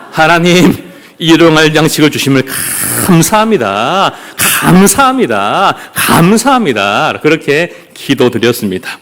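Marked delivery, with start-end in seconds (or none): 0.1-7.2 praying tiger. Acted. Tiger thanking God for food, mock-piously praying. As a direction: intone each thanks with comic reverence. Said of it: "이 호랑이도 기도를 했습니다".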